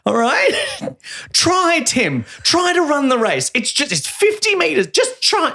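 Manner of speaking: pushy coach. Pretend. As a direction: urge a reluctant kid on. Mock coach urging a boy.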